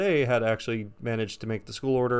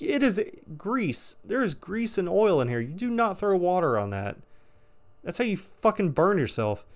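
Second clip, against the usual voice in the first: exasperated scolding; 7 semitones higher than usual. Retold or imitated. Yelling at coworker about fire.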